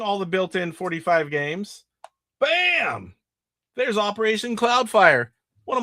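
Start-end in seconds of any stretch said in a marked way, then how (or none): none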